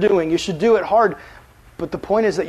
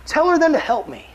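pleading Martha; 6 semitones higher, 4 dB louder than usual. Acted. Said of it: "she went up to him and said".

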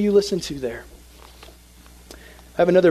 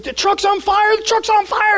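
high panicked yell; imitating a panicked worker screaming fire; frantic scream. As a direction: shout the alarm over and over. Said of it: "he's, like, screaming".

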